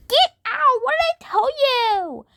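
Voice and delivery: sharp, loud, high yell of get out; angry shout. Real, not acted. Kid yelling at sister to leave, angry shout. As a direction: shout her out of the room.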